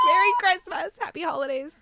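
festive shout. Real, not acted. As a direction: shout it like the show's big sign-off. Cheerful sign-off greeting.

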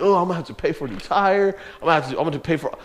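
rapid worried grumble; fast grumbling. Pretend. Pastor voicing a pretend grumble about tire costs, fast grumbling. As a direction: rattle off the complaint quickly. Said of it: "I could have been so mad at God".

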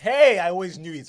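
loud laughing burst; laughing shout. Real, not acted. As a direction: crack up and shout.

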